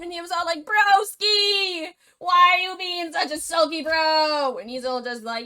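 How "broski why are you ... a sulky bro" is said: loud greeting. Acted. Mule bursts into swamp.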